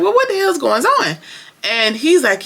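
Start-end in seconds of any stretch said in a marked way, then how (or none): 0.0-1.2 much higher, shocked imitation of Danny; mock shocked. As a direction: squeal it like a stunned boss. Danny's reaction retold.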